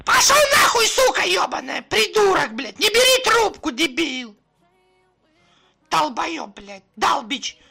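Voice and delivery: very high, loud screeching tirade; screamed prank abuse. Pretend. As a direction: scream curses down the phone. Screams abuse at whoever answered.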